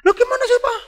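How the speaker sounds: put-on loud, very high child's wail; whining child. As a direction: play a kid pestering Dad at full pitch. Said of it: "Anaknya merengek". (suara anak merengek)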